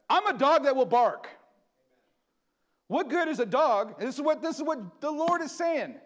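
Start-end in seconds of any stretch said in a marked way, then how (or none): 0.1-4.4 louder, emphatic preaching; preacher raising his voice. Real, not acted. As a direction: declare it with force. Preacher building to a shout.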